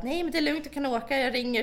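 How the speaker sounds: high-pitched breezy reassurance; reassuring grandmother. Retold or imitated. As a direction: imitate a grandmother waving off worry. (härmad lugnande mormor, hög röst)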